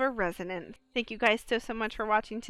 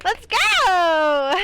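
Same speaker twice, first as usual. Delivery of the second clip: excited cheer; 7 semitones higher, 11 dB louder than usual. Real, not acted. Streamer celebrates a multi-kill.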